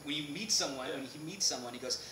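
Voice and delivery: usual speaking voice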